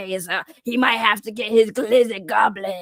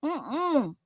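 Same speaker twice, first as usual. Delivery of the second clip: ordinary delivery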